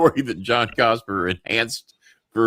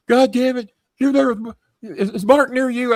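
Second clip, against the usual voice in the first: high-pitched, louder angry boss impression; furious; 4 dB louder than usual. Boss phoning about Mark's mistake, furious. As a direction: bawl down the phone as an irate boss. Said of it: "they're fucking furious".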